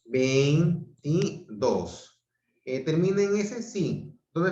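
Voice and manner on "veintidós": VEINTIDÓS stretched slowly, syllable by syllable. Shown as a demonstration. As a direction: sound out Veintidós in long, separate syllables. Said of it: "lo voy a silabear".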